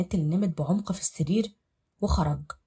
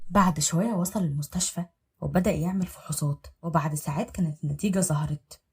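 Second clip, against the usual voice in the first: ordinary delivery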